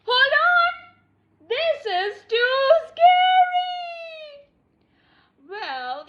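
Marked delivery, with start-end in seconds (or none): 0.0-4.5 high, loud, panicky protest; frightened cry. Acted. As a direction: cry out in fear that it is too scary. Character frightened by the story.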